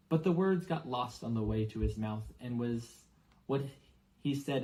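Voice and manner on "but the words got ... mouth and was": usual speaking voice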